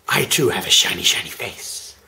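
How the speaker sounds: low, breathy menacing whisper; spooky monster whisper; scary creepy voice. Pretend. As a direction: creep them out with a sinister hiss. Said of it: "That was scarier than necessary".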